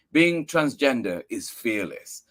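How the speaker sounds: ordinary delivery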